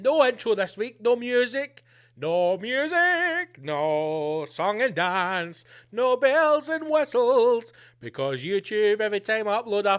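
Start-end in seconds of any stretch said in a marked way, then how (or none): 2.2-3.6 higher, very slow, stretched syllables; drawn-out mock announcement. Host tells listeners there is no intro, drawn-out mock announcement. 3.6-7.7 slow sing-song with stretched words; drawn-out mock announcement. Host lists what the intro lacks, drawn-out mock announcement.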